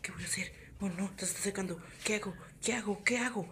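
panicked whispered inner thoughts; nervous whisper. Pretend.

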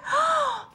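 high storybook gasp; sharp acted intake of breath; shocked gasp. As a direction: gasp at startling news. Storyteller gasps after the news.

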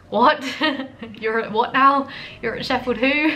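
high, loud, laughing double take; loud shocked disbelief. Retold or imitated. To the repairman who studied in Sheffield, loud stunned disbelief. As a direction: shout it out, floored by the news.

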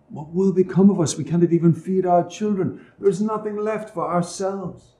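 a poor father fretting to his wife; worried father. A narrator's reading.

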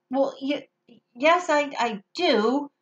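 hesitant stammer with broken starts; embarrassed witch stammering. Acted. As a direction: trip over the words, caught out. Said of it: "she stammered".

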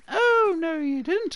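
a high, sassy squeal at the sister; mock sassy retort. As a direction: fire it back in a high squeal. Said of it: "You'd be like".

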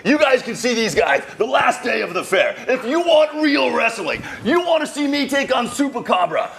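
booming wrestling promo voice; wrestling announcer voice. Acted. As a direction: hype it up like a ring announcer. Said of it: "that deep voice of yours".

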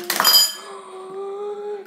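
sharp frightened gasp; startled gasp. Real, not acted. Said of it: "That scared me".

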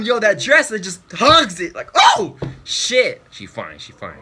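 ordinary delivery

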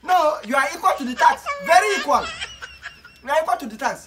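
high, loud laughing tease; laughing mockery. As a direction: tease him through laughter. Son mocks father laughing.

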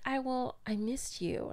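quietly imitating his soft plea; a hushed, sheepish I missed you; soft-spoken quote. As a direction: mumble it softly, hurt. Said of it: "'Cause you were like".

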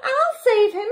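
very high squeaky squirrel; squeaking. A narrator's reading. Squirrel offering to rescue the duck, squeaking. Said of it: "squeaked the squirrel".

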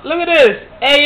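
ordinary talk, nothing marked

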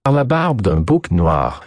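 put-on French oath in a French accent; lively French-accented exclamation; exclaiming Frenchman. A narrator's reading. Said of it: "de Grandin exclaimed".

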